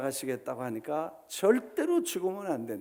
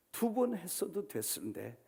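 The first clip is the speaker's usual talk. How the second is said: ordinary delivery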